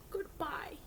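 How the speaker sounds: ordinary delivery, nothing marked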